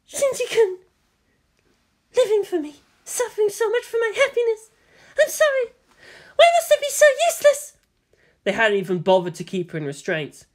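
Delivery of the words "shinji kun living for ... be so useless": very high, tearful little voice; whimpering. A narrator's reading. Captive woman blaming herself for the boy's suffering, whimpering. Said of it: "She whimpered".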